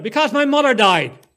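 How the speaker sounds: loud, high anguished shout. A narrator's reading. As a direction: yell it at her in grief. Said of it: "I turned on her and shouted".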